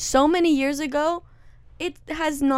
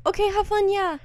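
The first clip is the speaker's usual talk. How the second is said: lightly acted easygoing send-off, higher pitched; breezy okay, have fun; 8 semitones higher than usual. Girlfriend pretending to be fine with it, breezy mock approval. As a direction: toss it off as a high, agreeable okay. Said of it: "it's hard to not be like".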